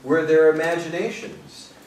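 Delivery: projected recital, slowing into the final line; deliberate, weighted phrasing; poetry reading. Acted. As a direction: read it as a poem, letting the ending settle. Reads a poem aloud.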